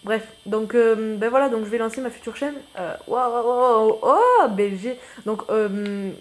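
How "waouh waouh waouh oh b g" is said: wow surprised. Real, not acted. Surprise en découvrant la chaîne.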